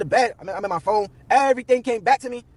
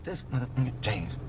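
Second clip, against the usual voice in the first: muttered much softer and lower under the breath; inner thought; 9 semitones lower, 10 dB quieter, slower than usual. Retold or imitated. Thinking angrily about James, muttered low and soft. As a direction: bite back the curse quietly. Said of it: "I'm in my head like".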